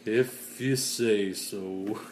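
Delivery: slow, resigned gloomy donkey impression; Eeyore. Gloomy donkey doubting that's good, mock mournful. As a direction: drag each word out, mock mournful. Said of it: "poor Eeyore. That's me".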